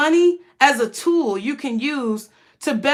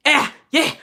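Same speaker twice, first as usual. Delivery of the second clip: lightly voiced preacher calling out; a touch of a preacher urging the congregation. Mimicking a pastor urging the church, lightly hammed. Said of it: "That's how them pastors be".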